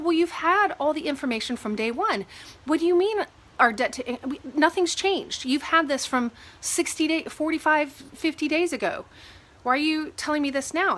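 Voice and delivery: mildly heated retelling of a complaint; retold frustration. Retold confronting the lender.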